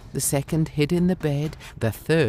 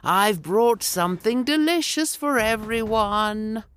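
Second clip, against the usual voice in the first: high, slow coaxing voice at the door; wolf posing as mother; 9 semitones higher than usual. A narrator's reading. The wolf calls through the door pretending to be mother, sugary and high. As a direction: coax the children to open up, slow and high. Someone called out.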